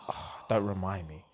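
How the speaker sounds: low, very soft imitation of regret; mock dread. Man reacting as she tells them they had sex, mock dismay. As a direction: sink into it quietly, as if mortified.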